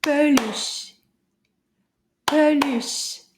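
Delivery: "Peluche" in two firm beats; clapped syllables. Shown as a demonstration. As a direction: beat out both syllables of "Peluche". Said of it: "see if we can clap the syllables".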